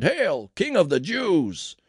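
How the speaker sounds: loud, high, drawn-out mock salute; mocking taunt. A narrator's reading. Soldiers kneeling before Jesus in mockery, taunting. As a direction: jeer it slowly as a false acclamation. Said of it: "in mockery and taunted".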